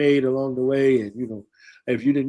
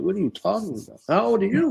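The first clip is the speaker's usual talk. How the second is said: incredulous; 7 semitones higher, faster than usual. Retold or imitated.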